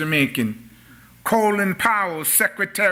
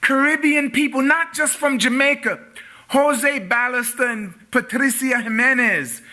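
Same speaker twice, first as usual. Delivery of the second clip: higher-pitched pulpit proclamation; preaching; 7 semitones higher than usual. Real, not acted. Preacher lifts into oratory.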